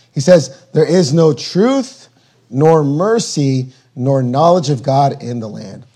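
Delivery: normal talking voice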